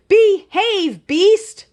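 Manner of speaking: high, loud commanding call. Acted. Princess calls to the sea monster, a loud high call. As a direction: shout it across the beach. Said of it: "cupped her hands around her mouth".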